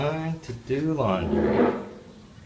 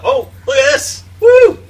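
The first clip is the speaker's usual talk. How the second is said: squealing, much higher and louder; delighted surprise; 8 semitones higher, 13 dB louder than usual. Real, not acted. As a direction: shriek with joy at the find. Finds money in pocket.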